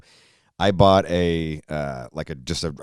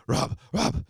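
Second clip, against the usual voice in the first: put-on higher, softer needy drunk call; drunk Vogbag; 10 semitones higher, 6 dB quieter than usual. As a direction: plead for attention tipsily. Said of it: "he comes up like".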